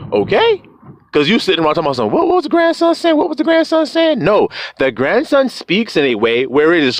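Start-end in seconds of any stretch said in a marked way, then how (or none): 2.1-4.2 high, fast mocking chatter; mimicked confusion. Listeners asking what grandson said, mocking high chatter. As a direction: parrot puzzled listeners in a high rush.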